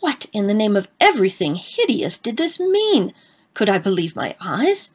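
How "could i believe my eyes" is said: ordinary delivery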